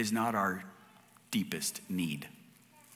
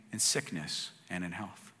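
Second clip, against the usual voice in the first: quiet vow; 6 semitones lower, 7 dB quieter than usual. Retold or imitated. Wife recalls her vow.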